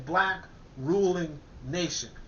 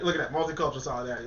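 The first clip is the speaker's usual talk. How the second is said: ordinary talk, nothing marked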